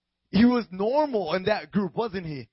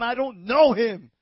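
loud, slow, raised protest; vehement denial; 4 semitones higher, 8 dB louder than usual. Acted. Peter's last denial of Jesus, emphatic shout. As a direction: hammer it out slowly and loudly.

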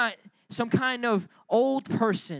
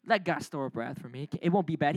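much lower and softer, shrugging it off; low dismissive mock; 9 semitones lower, 5 dB quieter, faster than usual. Preacher mocking careless sinner, low and dismissive. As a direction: toss it away like it means nothing.

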